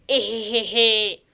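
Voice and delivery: slow giggle. Acted. Giggle sitting on furniture.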